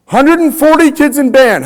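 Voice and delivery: high and loud, announcing the number; loud crowd-pumping announcement; excited exclaim. Real, not acted. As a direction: hype up the big number to the crowd. Answering his own question to the crowd.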